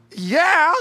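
high, loud hearty yeah; booming mentor yes. Retold or imitated. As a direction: belt it out like a big-hearted mentor. Mentor's big reply in golf cart.

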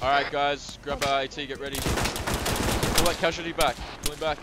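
loud urgent commands under fire; shouting orders over battle. Real, not acted. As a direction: yell orders to the squad. Squad leader under attack.

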